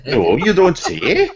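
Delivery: high, hammed-up Welsh accent; taffy Welsh accent. Joking about voice commands in a put-on Welsh accent. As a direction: ham up a sing-song Welsh voice. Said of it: "Apologies for my awful, awful accent".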